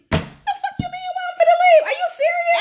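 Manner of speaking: very high, incredulous yell; hammed-up outrage, voice shooting up; mock screeching disbelief; outraged retort. Acts out angry retort on leaving.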